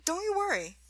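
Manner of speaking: high, lilting reassurance, falling at the end; breezy reassurance. A narrator's reading. Grimes falsely reassures the cast.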